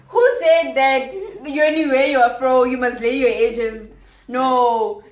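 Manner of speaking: loud, heated challenge; loud rant. Real, not acted. Ranting at people policing afros, loud heated challenge. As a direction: push back hard.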